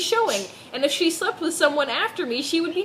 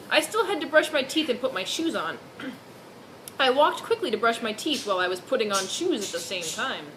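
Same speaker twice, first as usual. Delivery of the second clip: ordinary delivery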